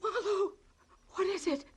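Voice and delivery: high, halting, frightened questions; dreading the answer; fearful. Acted. Fearing husband is dead.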